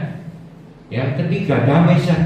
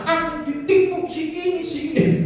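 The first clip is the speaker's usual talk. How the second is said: play-acted, high-pitched griping; mock wailing complaint; 13 semitones higher than usual. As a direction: whine an accusation in a much higher voice. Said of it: "meraung-raung, bikin status di Facebook menyalahkan".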